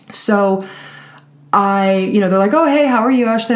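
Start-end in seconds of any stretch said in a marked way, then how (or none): 2.5-3.5 mock cheery greeting. Mocking client's cheery greeting.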